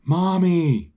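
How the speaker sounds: high, drawn-out call for mom; acted yell. A narrator's reading. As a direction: call out high like a child yelling from bed. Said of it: "he's yelling from his bed".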